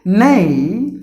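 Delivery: "Nay" loud and drawn out. Shown as a demonstration. Teacher showing the stressed syllable of intonation, loud NAY. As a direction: punch and stretch the syllable "Nay". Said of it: "should be given more volume".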